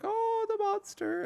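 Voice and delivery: high, drawn-out mock wail; hammed-up high-pitched warning; mock villager wail. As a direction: wail it out in a high, slow moan. Voicing an alarmed villager in story.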